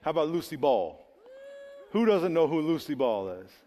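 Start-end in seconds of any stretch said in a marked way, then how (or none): none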